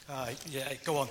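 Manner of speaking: ordinary delivery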